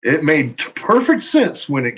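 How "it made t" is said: ordinary delivery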